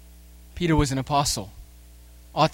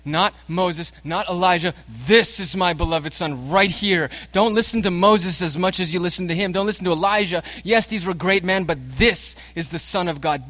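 louder, higher, emphatic preacher's voicing of God; declaring as God; 5 semitones higher, 6 dB louder than usual. God declares his Son to terrified disciples, forceful proclamation. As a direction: proclaim it forcefully, setting the Son above the prophets. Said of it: "God from heaven declares".

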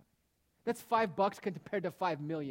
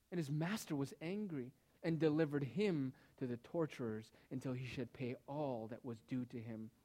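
ordinary delivery

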